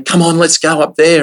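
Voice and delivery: eager urging. Retold or imitated. Rick urging them to dive again.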